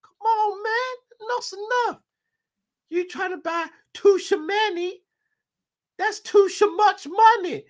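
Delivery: high squeaky put-on owner voice, hammed up; J W Henry impression. Owner ranting about spending all his money, in a squeaky high mock voice. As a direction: whine about your money in a silly high voice. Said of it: "his J W Henry impression".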